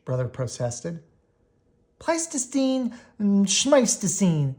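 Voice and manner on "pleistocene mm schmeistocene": mocking retort. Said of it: "shouted Sister".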